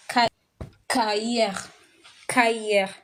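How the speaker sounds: cahier said ka-yay, the H silent; French cahier. Shown as a demonstration. Teacher breaking down cahier for students, syllable by syllable. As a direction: build up ca then cahier. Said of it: "this is how we're gonna read it".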